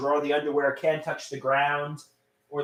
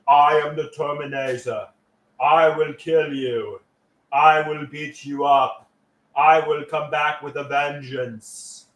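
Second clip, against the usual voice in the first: put-on slow, light Austrian accent; a hint of the Terminator, slowly; Arnold Schwarzenegger impression; 4 semitones lower, slower than usual. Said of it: "say as Arnold Schwarzenegger".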